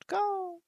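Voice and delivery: high croaking call; a short bird cry; raven croak. Acted. Raven punctuates his speech with a high croak.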